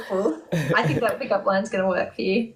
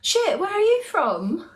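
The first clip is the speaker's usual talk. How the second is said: put-on higher-pitched London accent; 7 semitones higher than usual. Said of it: "with that accent".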